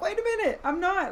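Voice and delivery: mock double-take, pitched high; sudden protest. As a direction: pull yourself up short, high-pitched. Acted sudden second thought.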